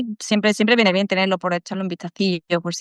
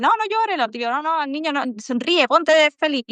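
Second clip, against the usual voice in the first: higher, mock-brisk "don't cry, cheer up"; dismissive adult to crying child; 7 semitones higher than usual. Adult hushing a crying child, mild mock brisk tone. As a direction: lightly play a grown-up brushing off a child's tears. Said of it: "tú le dices".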